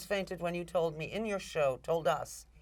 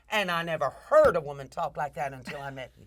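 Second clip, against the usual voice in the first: a father's Southern accent, retold; 3 semitones lower, 4 dB louder than usual. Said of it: "said in his southern accent".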